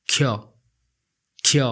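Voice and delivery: ख्य with a kh sound, no s sound at all; Odia letter khya. Shown as a demonstration. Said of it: "यहाँ पे स sound नहीं आएगा". (ख्य में स नहीं, ख की आवाज़ साफ़ बोलें)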